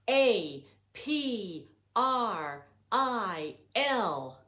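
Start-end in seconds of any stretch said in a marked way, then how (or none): none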